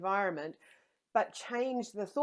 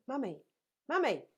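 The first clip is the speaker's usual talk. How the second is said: put-on child calling louder and higher, rising to a whine; nagging child; 10 semitones higher, 8 dB louder than usual. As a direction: play the kid who wants attention, building up. Child nagging parent for attention.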